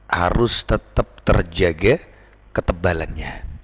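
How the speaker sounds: ordinary delivery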